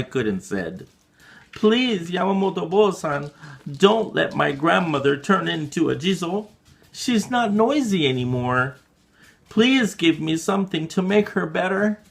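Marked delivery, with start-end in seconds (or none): 1.5-12.0 put-on high, urgent child's voice; desperate pleading child. Child begging the priest to save grandmother, high and urgent. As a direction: beg like a frightened kid.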